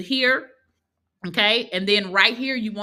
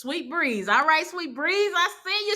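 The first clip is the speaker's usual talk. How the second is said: usual speaking voice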